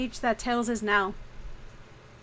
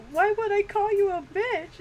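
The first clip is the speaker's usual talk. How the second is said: mock puzzled boyfriend; 10 semitones higher than usual. Retold character, puzzled voice.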